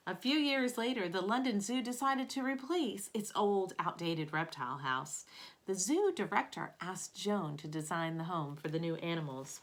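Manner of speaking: expressive picture-book narration; storybook read-aloud voice; reading to children. Acted. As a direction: read it aloud to a class of kids. Reads picture book to class.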